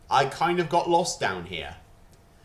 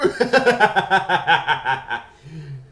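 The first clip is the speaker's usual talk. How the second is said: long, loud laugh; loud laughing fit; 6 dB louder than usual. Real, not acted. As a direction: burst into a big laugh. Laughs after own impressions.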